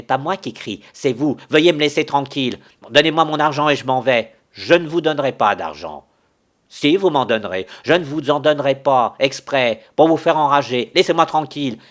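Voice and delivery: loud, fast angry retorts; heated shouting. Acted. Quarrelling pair shout over the debt, loud and fast. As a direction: fire each line back at full volume.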